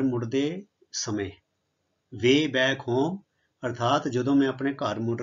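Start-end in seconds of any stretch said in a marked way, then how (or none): 2.1-3.2 Way back home drawn out word by word, ending on a rise; very slow. Shown as a demonstration. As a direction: lay it out slowly for the class. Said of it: "ਹੁਣ way back home ਮੈਂ ਮਤਲਬ".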